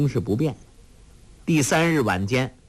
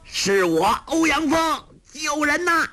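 much higher voice, long cry for help; drowning villain crying out; distant shout. A narrator's reading. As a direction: yell from far off in the water. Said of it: "欧阳锋在远处叫道".

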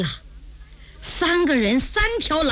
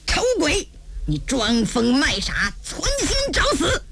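angry threat. Acted. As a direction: rebuke her, pitching up at the end. Disgusted swordsman threatens the girl. (恼怒高声威胁)

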